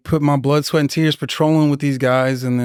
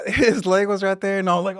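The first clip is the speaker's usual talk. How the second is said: ordinary talk, nothing marked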